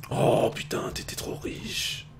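hushed, breath-only amazement; very soft, drawn-out whispered wonder; awed whisper. Real, not acted. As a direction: whisper it slowly, marvelling at the loot. Amazed while looting a rich corpse.

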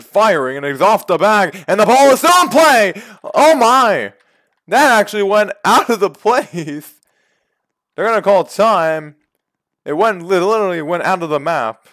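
high, loud disbelieving call; excited call. Acted. Gamer calling a ball out of the stadium, excited. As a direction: call the crazy play at full pitch. Wild play called live.

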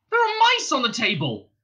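high, loud alarmed cry; alarmed yelp. A narrator's reading. Arthur glances round and sees mice, yelping. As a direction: yelp it out in shock. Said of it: "suddenly yelped".

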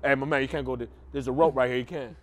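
low, firm bouncer blocking the rope. Retold or imitated. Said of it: "he's like".

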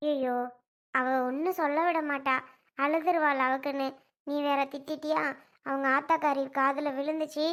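put-on low, soft elderly-relative voice; grumbling grandmother. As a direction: grumble low, then coax the child over. Plays grandmother grumbling, calling child. (தணிந்த குரலில் புலம்பும் அப்பத்தா)

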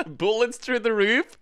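amazed at the bullets; incredulous. Real, not acted. Laughing disbelief.

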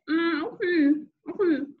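high hummed reply, muffled; closed-mouth mumble. A narrator's reading. Boy answering mother with stuffed mouth, mumbling. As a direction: hum twice through sealed lips. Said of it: "I said, trying not to open my mouth".

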